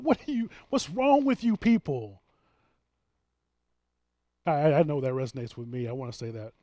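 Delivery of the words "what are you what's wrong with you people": mock indignant Jesus. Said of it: "It says he was indignant".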